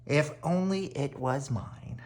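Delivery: soft, wistful wish; wistful Henry. A narrator's reading. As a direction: sigh it out longingly. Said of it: "he would sigh".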